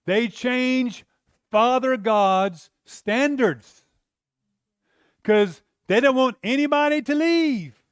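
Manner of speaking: loud, high preaching cry; preaching at full force. Acted. Preacher at full force to the congregation, loud and high. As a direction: drive the point home from the pulpit.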